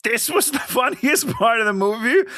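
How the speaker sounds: can't believe that was his favourite part; laughing disbelief. Real, not acted. Disbelief at co-host's favourite scene.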